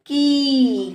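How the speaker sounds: की with the ee held long and level. Shown as a demonstration. Teacher shows pupils the matra on का, vowel held long. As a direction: stretch the vowel of की, easing down at the end. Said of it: "तो इसको बोलेंगे".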